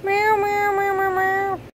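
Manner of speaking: high sing-song kitty meows; playful meowing. Pretend. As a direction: meow like a cat for the baby. Mom meowing at baby in cat hat.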